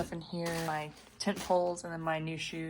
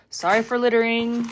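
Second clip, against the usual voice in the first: loud, raised sing-out; playful called-out apology; 5 semitones higher, 13 dB louder than usual. Pretend. Hiker apologizes to the desert for littering, playful holler. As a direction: call it out high as if to the distance.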